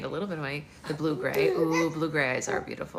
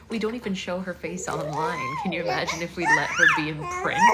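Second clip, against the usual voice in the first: laughing retort; 14 semitones higher, 4 dB louder than usual. Retold or imitated. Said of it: "we were like".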